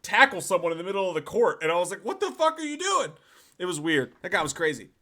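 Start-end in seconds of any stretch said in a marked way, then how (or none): 2.0-3.1 high-pitched, baffled question at usual volume; mock incredulous outcry. Asking a teammate who tackled someone, imitated incredulity. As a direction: re-enact disbelief at a teammate. Acts own shocked reaction to tackle.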